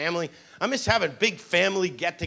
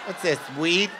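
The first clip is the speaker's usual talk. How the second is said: mock fussy customer; 4 semitones lower, 7 dB quieter than usual. Mocking fussy bread checker.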